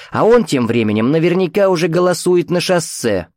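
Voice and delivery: mocking, lecturing mother's voice; caustic, domineering mother. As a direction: needle her with absolute self-assurance. Mother's nagging voice in head.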